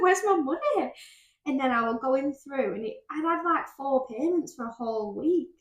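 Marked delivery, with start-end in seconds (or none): 0.0-0.9 mock panic. Panicked quote after laugh.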